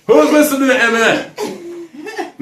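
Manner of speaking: high, drawn-out squeal of disbelief; mock incredulous. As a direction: stretch the question out slowly and high, with a laugh. Incredulous mock repeat of question.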